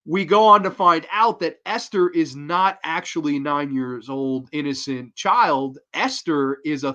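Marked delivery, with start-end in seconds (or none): none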